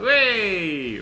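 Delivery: high, loud gliding squeal of fun; drawn-out whee sliding down; playful whee. Acted. Voices the falling Hulk.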